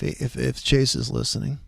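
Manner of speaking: usual speaking voice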